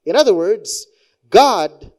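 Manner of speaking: normal talking voice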